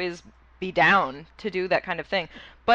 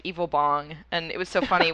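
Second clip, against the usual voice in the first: ordinary talk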